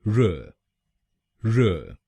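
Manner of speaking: र as a weak "ruh"; just a light र, ruh; fourth syllable. Shown as a demonstration. Teacher modeling the fourth syllable: र as a reduced ruh. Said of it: "हम बोलेंगे".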